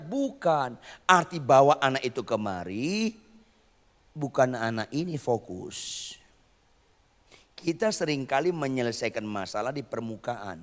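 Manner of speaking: ordinary talk, nothing marked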